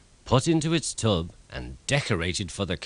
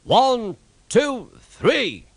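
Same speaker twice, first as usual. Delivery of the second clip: much higher, louder count; booming count; 12 semitones higher, 5 dB louder, much slower than usual. A narrator's reading. Fat Controller counting in the carol concert, loud booming count. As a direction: boom the count to the crowd. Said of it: "boomed the Fat Controller".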